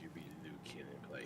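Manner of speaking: quick breathless whisper echoing the line. Real, not acted. Cohost whispers back the suggestion, quick teasing whisper. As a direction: hush it fast like a teasing aside. Mocking echo of host's suggestion.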